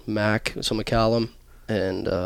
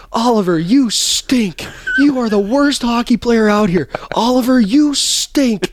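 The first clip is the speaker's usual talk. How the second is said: high, loud, slow self-scolding yell; yelling at himself; 7 dB louder, slower than usual. Retold or imitated. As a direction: chew yourself out after a blown breakaway. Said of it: "he's yelling at himself".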